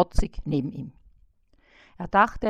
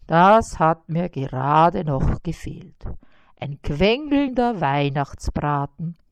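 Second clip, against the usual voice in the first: a lightly voiced grumpy spider, louder; groaning; 6 dB louder than usual. A narrator's reading. Spider Karl-Heinz groans at the whining fly. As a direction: groan it out like a sleepy old spider. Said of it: "stöhnte Karl-Heinz".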